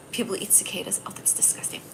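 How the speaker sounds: unvoiced, soft disgusted aside; whisper. Real, not acted. Aside to live viewers about cicadas, a disgusted whisper.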